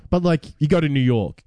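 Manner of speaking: usual speaking voice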